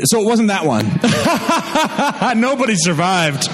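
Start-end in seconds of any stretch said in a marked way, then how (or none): 0.9-2.4 high burst of laughter; rapid, high-pitched real laughing fit. Real, not acted. Host cracks up at own mistake. 2.4-3.5 real laughter spilling into the words; helpless laugh around the words. Real, not acted. Host laughing at grim realization.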